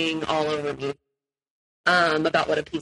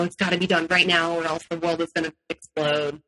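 ordinary talk

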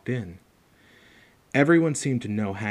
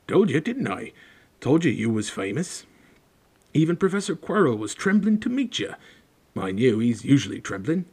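rustic big-man character, lightly done; lightly voiced Hagrid, pleased; 4 semitones higher, 4 dB louder than usual. A narrator's reading. Hagrid reminds Harry he is famous, lightly voiced and pleased. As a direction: tease with pride in a rustic dialect.

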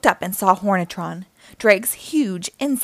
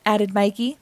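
usual speaking voice

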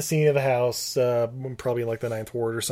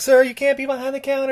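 mock clerk; 12 semitones higher, 5 dB louder than usual. Clerk scolding Nicolas Cage.

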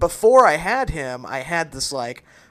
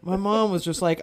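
usual speaking voice

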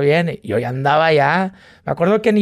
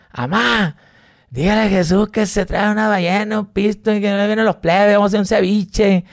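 higher happy call to mom; excited call; 6 semitones higher than usual. Retold or imitated. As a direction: call out proudly, pitched up.

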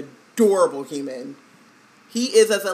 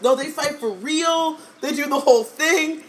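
excited; 8 semitones higher, 3 dB louder than usual. Retold or imitated. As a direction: sell it like it's real. Retelling her insistence as a kid.

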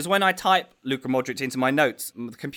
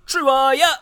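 announcer intro; 10 semitones higher, 5 dB louder than usual. Acted. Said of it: "time for your favourite segment".

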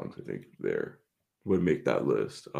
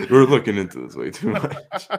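laughing; 6 semitones higher, 7 dB louder than usual. Real, not acted. Laughing at their own overanalysis.

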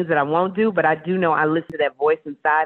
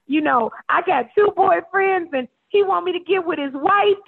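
mock caller voice; 12 semitones higher than usual. Caller on the Ask Wendy segment, high naive mock voice. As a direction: spell out a messy love life innocently. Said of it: "the girl was like".

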